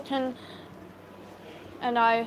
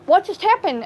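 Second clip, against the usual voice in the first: higher, louder startled question; sudden alarm, pitched up; 7 semitones higher, 7 dB louder than usual. Retold or imitated. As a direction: jolt round at a sudden scream. Sitting up alarmed by scream.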